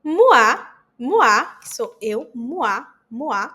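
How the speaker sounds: moi said as mwa, first takes loud; teacher drills the oi sound; oi sound demo. Shown as a demonstration. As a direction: punch the wa in moi. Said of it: "O I tem som de U A".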